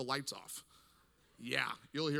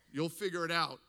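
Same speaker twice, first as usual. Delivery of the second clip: normal talking voice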